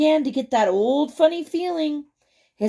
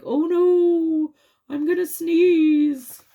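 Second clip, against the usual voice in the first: mock worried Robert; 5 semitones higher, slower than usual. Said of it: "He's like".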